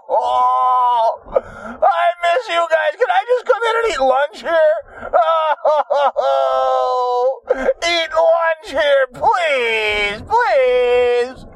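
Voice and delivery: high, wailing fake crying; mock sobbing plea. Begging coworkers to let him eat lunch, fake sobbing. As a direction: whimper and beg, hammed-up.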